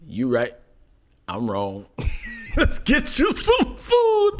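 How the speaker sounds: high, slow, laughing; laughing mock apology. Careless boyfriend apologizing while laughing, high and slow. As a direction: laugh through a careless sorry.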